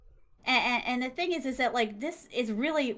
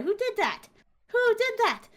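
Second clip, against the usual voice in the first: high-pitched, rising mock outrage; mock indignation; 10 semitones higher than usual. Patient protesting the doctor's taped-up beds, mock indignation. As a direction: demand an answer, higher the second time.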